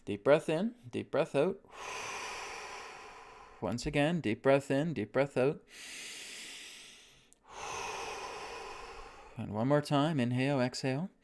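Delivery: calm breathing cues; soft coaching voice for each breath; guided breathing. Shown as a demonstration. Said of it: "let's go back into that neutral alignment".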